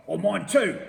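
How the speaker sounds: shouted. A narrator's reading. Mister Twit shouting about the droppings. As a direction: shout back in disgust. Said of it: "shouted Mister Twit".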